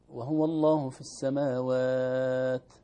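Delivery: السماوات with a crisp plain taa; slow recitation. Shown as a demonstration. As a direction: draw out وهو الله في السماوات slowly. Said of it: "كأنه همس السين".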